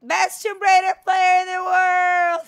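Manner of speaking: high, loud victory yell; triumphant shout. Real, not acted. Streamer celebrating a win, shouting triumphantly. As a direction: crow it to the whole room.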